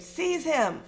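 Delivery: high, urgent commanding scream; a dark lord barking a desperate order. Acted. Voldemort orders Quirrell, high urgent scream. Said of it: "Voldemort screamed".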